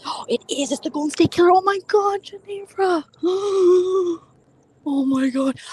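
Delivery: high-pitched, breath-catching disbelief; shocked gasping. Real, not acted. Reacting to Golden State Killer news, shocked gasping. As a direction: gasp and let the pitch shoot up.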